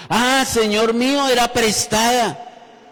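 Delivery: high, loud cry, drawn out; dismayed cry. A narrator's reading. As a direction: wail over the borrowed axe. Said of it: "y gritó diciendo". (lamento agudo y fuerte)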